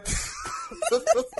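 high wheezing laugh fit; hysterical laugh. Real, not acted. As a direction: collapse into helpless laughter. Breaks down laughing again.

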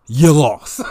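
laughing while gloating at a rival fan; laughing taunt. Real, not acted. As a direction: chuckle through the taunt. Gloating over rival's predicted record.

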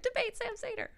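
high, taunting heckle; mock heckle. Fans heckling the pundit in a high taunting voice. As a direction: needle him like a troll.

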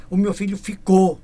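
Lisbon "ficou" with a plain closed o ending; Lisbon pronunciation. Retold or imitated. Singer quoting a Lisboner to the host, Lisbon "ficou". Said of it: "da própria de Lisboa".

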